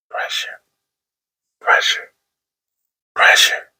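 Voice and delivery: eerie repeated whisper. Acted. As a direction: breathe it like a haunting echo. Whispered echo effect.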